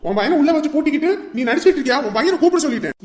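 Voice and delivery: loud, high, fast yelling; furious yell; angry shout. Acted. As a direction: accuse her of hiding her son. Brother yelling at mother.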